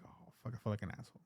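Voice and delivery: soft and fast, embarrassed; sheepish mutter. Retold or imitated. Realizing the stranger was just tripping, a soft, fast sheepish mutter. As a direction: mumble it quickly to yourself.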